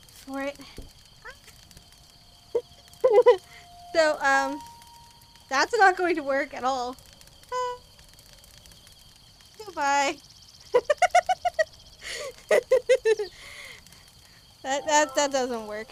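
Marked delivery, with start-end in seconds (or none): 2.5-3.9 bursting out laughing; big burst of laughter; loud laugh. Real, not acted. Falls off beanbag chair. 10.2-14.0 howling with laughter; big helpless laugh; loud laugh. Real, not acted. Crushed under beanbag.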